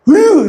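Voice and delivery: sharp high exasperated ugh; exasperated groan. Retold or imitated. Mother groaning at her hurt child. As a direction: let out a mother's fed-up groan.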